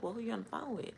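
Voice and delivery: high, pointed suspicious question. Retold or imitated. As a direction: demand an answer, suspicious. Retold questioning boyfriend.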